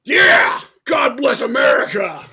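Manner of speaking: loud, high rallying shout; mock patriotic cheer. Singer joking about beating up a veteran, mock patriotic shout. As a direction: holler it like a battle cry, hammed-up.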